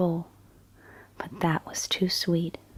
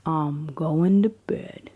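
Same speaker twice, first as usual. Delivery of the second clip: whispered bedtime story. A narrator's reading. Storyteller reading Goldilocks at bedtime, in a hushed whisper. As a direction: whisper the tale as if a child is drifting off.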